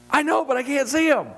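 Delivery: high, strained retold protest; mock exasperation. Dad answering son on a hunt, hammed-up exasperation. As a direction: play up the exasperated comeback for laughs.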